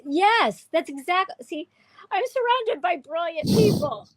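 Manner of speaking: high-pitched, gushing validation; light up with sudden, emphatic delight; delighted agreement. Real, not acted. Host praising a viewer who understood them, delighted.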